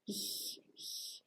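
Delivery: whispered German r in the throat, ir ir; soft breathy ir. Shown as a demonstration. Teacher demoing a soft throat R, whispered. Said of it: "Então, ir".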